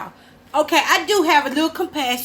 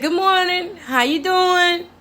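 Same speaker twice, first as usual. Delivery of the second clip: higher, slow, drawn-out good morning; sweet sing-song greeting; 6 semitones higher, slower than usual. Retold or imitated. Texting a guy each morning, sweet high sing-song hello. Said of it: "I talk like this in real life".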